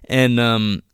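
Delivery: usual speaking voice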